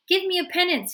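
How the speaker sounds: loud, high demanding growl. A narrator's reading. Bones growling at the priest for a penance. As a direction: demand it fiercely. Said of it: "he growled".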